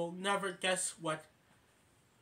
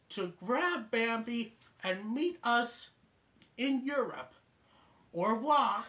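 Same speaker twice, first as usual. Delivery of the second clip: higher and louder, slightly slow and clear; recorded message; 5 semitones higher, 3 dB louder than usual. Retold or imitated. Right button playing the message to Miss Miller, higher and clear.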